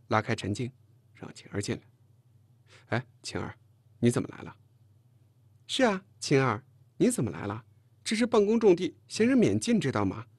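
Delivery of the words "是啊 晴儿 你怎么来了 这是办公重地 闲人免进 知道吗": pointed, hostile echo turning into higher scolding; unfriendly. A narrator's reading. Chen Jing warning the girl off the office, unfriendly. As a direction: make the visitor feel unwelcome. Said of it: "陈静在旁边的态度很不友好".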